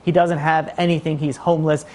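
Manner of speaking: normal talking voice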